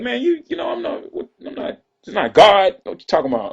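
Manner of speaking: high, strained mock rant of a heated rapper; indignant rant. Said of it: "he was like".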